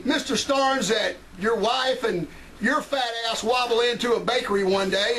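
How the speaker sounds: ordinary delivery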